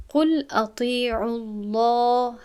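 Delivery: قل اطیعوا الله drawn out with a full, heavy lam; heavy lam in Allah. Shown as a demonstration. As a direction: recite الله slowly with a thick lam after the damma.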